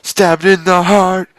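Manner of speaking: slow, high incredulous repeat; high, slow mock-dramatic repeat; disbelieving repeat. Real, not acted. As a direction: savor the absurdity, slowly. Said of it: "reader repeats in disbelief".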